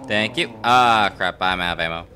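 ordinary talk

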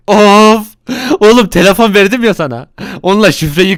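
loud, high fed-up rebuke; a boss at his limit; exasperated scolding. Acted. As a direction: scold the hacker. Boss scolds hapless hacker.

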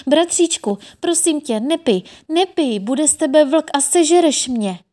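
high, loud anxious begging; pleading cry. A narrator's reading. Sister holds brother back, pleading in alarm. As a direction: plead with him urgently.

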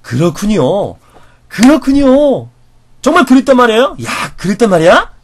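loud, overblown, astonished reaction; exaggerated amazement. Shown as a demonstration. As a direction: gush with interest at their story. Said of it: "따라해보세요".

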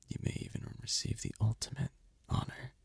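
ordinary talk, nothing marked

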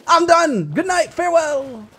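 high, wailed farewell; panicked surrender. Real, not acted. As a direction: cry out a frantic goodbye. Giving up amid panic.

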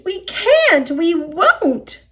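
high-pitched squealing refusal; high mock-panicked cry; screamed protest. A narrator's reading. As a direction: wail it high, as protesting freight cars. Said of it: "they screamed".

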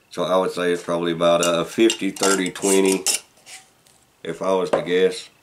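talking around pipe stem; pipe in mouth. Real, not acted. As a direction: lips clenched on pipe. Seen 3: Pipe stem clenched in mouth throughout the labelled speech.

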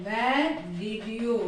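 very slow, each word spaced out for learners; slow model sentence. Shown as a demonstration. Teacher to Tamil students, modelling the question very slowly. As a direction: spell it out slowly for the class. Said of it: "teacher builds the sentence".

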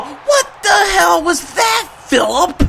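high, loud outraged yell; real furious yell at Phillip; outraged shout. Real, not acted. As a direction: scream at the quarterback in disbelief. Angry at quarterback's throw.

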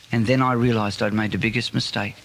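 ordinary delivery

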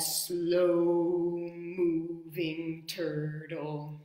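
slow, drawn-out turtle voice. Shown as a demonstration. Showing children how her turtle moves, slowly. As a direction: stretch the words out like a slow turtle. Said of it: "I like to do the turtle like this".

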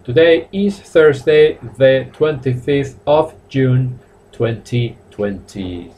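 slow, deliberate dictation of the date; measured teacher's model sentence, sinking at the end; slow British-style date. Shown as a demonstration. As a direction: spell out the date slowly, word by word.

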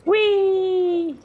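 long, high-pitched whee; gleeful squeal. Real, not acted. Player riding along in the game, gleeful high squeal. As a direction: squeal with delight on a ride.

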